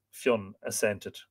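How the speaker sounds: ordinary delivery, nothing marked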